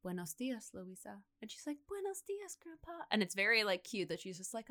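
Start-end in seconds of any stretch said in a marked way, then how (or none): 0.0-1.2 soft, lower, far-off mystical call; an eerie voice drifting through rubble. Retold or imitated. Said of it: "there's, like, mystical music playing". 1.9-3.0 high, soft, tender voice; little girl's reply. Retold or imitated. Hurt girl answers grandpa's call.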